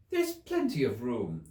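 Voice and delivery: indignant. A narrator's reading. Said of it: "said Alice indignantly".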